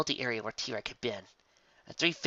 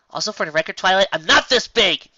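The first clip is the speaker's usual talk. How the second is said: indignant; 7 semitones higher, 13 dB louder than usual. A narrator's reading. Cadance indignant joke.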